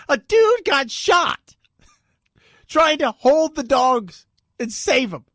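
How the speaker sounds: loud, high, drawn-out disbelief; loud, stretched-out indignation; incredulous outburst. Real, not acted. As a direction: belt it out slowly in exasperation. Mock outrage at ignored shooting.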